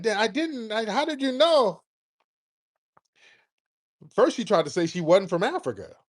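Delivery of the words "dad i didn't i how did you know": higher, mock flustered caller. Host imitates a flustered caller caught lying, higher mock voice. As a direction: imitate her caught out, pitched up. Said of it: "She's like".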